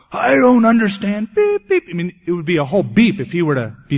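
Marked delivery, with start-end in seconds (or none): 0.1-1.3 pitched up and louder, exasperated; frustrated outburst. Retold or imitated. Angry visitor venting at the pastor, raised frustrated voice. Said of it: "He was angry". 1.3-1.8 high squeaky mock bleep noise; censor bleep. Pastor bleeping out a visitor's swearing, high mock beep.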